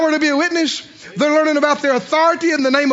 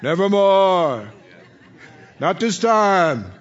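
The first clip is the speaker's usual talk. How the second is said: slow, drawn-out, lower raven call; croaking raven refrain; 4 semitones lower, slower than usual. Acted. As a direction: intone it like an ominous raven. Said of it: "Sounds like that raven again".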